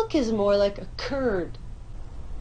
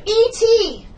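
excited shout; 10 semitones higher, 6 dB louder than usual. Acted. Actress hamming during take.

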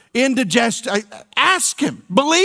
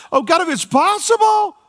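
anguished prayer; 9 semitones higher than usual. Retold or imitated. Preacher voicing Jesus praying in the garden, high and anguished. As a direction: plead upward in anguish. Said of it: "Jesus in the Garden of Gethsemane".

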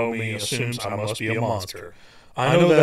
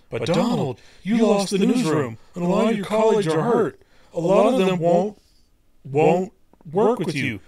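quoted editor; 6 semitones higher than usual. Retold or imitated. Editor quoted telling him off.

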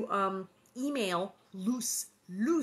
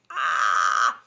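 excited shriek; 10 semitones lower, 11 dB louder than usual. Real, not acted. Thrilled about Rich Little show.

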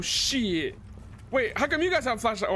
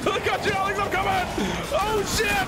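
high, urgent calling to teammates; worked-up gamer callouts; excited battle calls; 5 semitones higher than usual. Real, not acted. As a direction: call out mid-fight, rushing to save a friend. Calls out during rescue fight.

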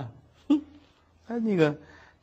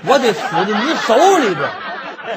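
higher and louder, laughing through it; laughing exasperation; 7 semitones higher, 7 dB louder than usual. Acted. Scholar teased by the maid, laughing despair. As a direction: groan in comic despair.

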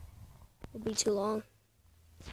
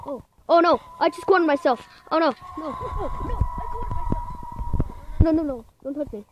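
high frantic pleading; panicked; 5 semitones higher, 8 dB louder than usual. Real, not acted. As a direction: beg in a panic. Cornered by the ghost.

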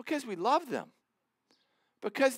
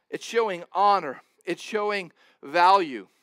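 usual speaking voice

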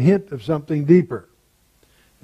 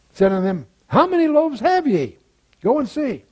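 scripture reading; 8 semitones higher than usual. Acted. Teacher reading the feeding passage to the class, lifted scripture reading. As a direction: read it with rising pitch on the disciples' words.

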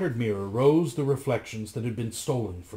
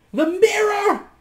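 very high, loud witchy screech; 9 dB louder, slower than usual. A narrator's reading. Witch demands her mirror, screeching. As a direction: screech it at the top of your range. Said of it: "screeched Giulietta".

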